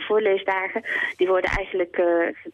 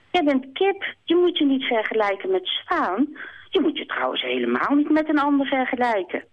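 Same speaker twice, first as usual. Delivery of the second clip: higher, kind hare comforting; 7 semitones higher than usual. Acted. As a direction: soothe a sad friend in a raised voice. Haas comforting Kip.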